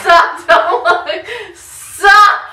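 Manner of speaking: loud laughing yells; laughing shout. Real, not acted. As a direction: shout stop through laughter.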